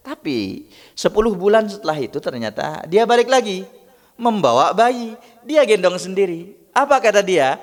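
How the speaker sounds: thrilled sermon climax; excited preaching. Real, not acted. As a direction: build the wonder of the news. Preacher builds to the miracle.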